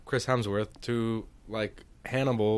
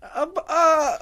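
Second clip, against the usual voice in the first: cracking up mid-sentence; high laughing break; 4 dB louder, slower than usual. Real, not acted.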